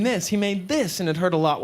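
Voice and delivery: normal talking voice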